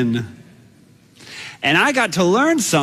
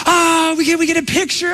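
excited beachgoers; 11 semitones higher than usual. Retold or imitated. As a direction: call out like a thrilled crowd. Excited beachgoers asking for photo.

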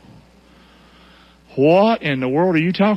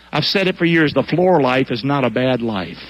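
ordinary talk

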